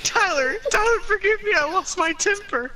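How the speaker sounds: giggling, high and breaking; can't stop laughing mid-line; laughing through lines. Real, not acted. Cracking up while reading.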